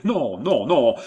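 acted exasperated outburst. A narrator's reading. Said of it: "dit Jacques, exaspéré".

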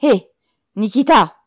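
high, loud repeated summons; impatient yell of a name; shouted call. A narrator's reading. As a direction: holler to get his attention. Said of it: "cria-t-il".

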